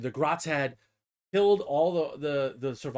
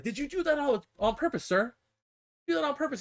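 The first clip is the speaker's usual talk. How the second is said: high, squeaky minion voice; imitated little grot; questioning grot; 11 semitones higher than usual. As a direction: ask the boss timidly in a tiny voice. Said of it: "And then the grot's like".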